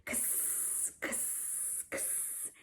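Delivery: kss as a breathy unvoiced hiss; X sound for kids; whispered X sound. Shown as a demonstration. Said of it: "make the X sound".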